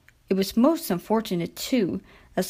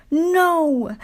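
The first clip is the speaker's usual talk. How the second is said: higher, louder plea; pleading; 8 semitones higher, 4 dB louder than usual. A narrator's reading. Skarloey pleading against a bus, louder higher plea. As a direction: beg them not to. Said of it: "pleaded Skarloey".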